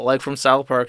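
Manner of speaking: ordinary talk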